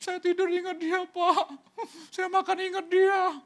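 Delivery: very high mock-tearful whine; whining lament. Bereaved spouse telling the pastor of missing them, mock wail. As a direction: imitate a mourner wailing high. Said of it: "baru nangis".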